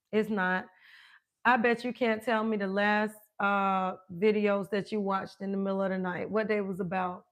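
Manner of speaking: usual speaking voice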